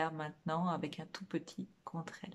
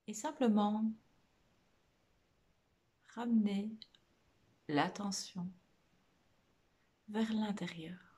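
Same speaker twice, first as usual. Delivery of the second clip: guided meditation; 4 dB quieter than usual. Real, not acted. Meditation teacher guiding listeners through a body scan, soft guided meditation. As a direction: lead them gently inward, phrase by phrase, leaving lots of space.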